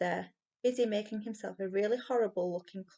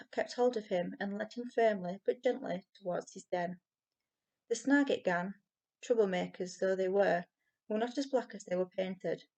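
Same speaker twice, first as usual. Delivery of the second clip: ordinary delivery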